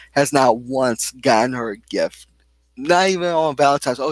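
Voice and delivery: usual speaking voice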